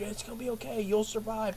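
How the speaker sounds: gently placating, hammed-up tenderness; mock reassurance. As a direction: hush it like fake comfort. Mocks a soothing reassurance from Titanic.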